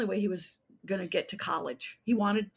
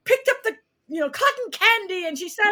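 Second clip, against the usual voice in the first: high, loud theatrical reading; dramatic reading voice; 12 semitones higher, 9 dB louder than usual. Shown as a demonstration. Playwright shows her class how she read aloud, loud and high. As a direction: read a story like it's a grand performance. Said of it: "I would always read like this".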